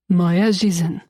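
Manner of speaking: put-on low male voice, light Russian accent. A narrator's reading. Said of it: "his slight Russian accent".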